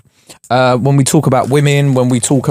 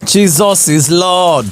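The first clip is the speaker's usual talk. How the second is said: slow, drawn-out awe at a gift; awed; 5 semitones higher, much slower than usual. Real, not acted. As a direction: savour each word in slow amazement.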